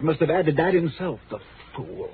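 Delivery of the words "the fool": very soft, voiceless aside; bitter mutter. Acted. Ghostwriter blaming his dead boss, bitter mutter. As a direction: curse him under your breath.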